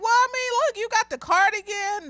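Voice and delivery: put-on high-pitched little-boy voice; high kid voice dropping toward the end. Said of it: "student, another little boy".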